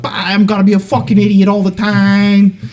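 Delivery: high-pitched hyped-up yell; manic idiot outburst. Acted. Joking to friends about his rowdy side, manic mock outburst. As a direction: blurt it out high in goofy mock mania.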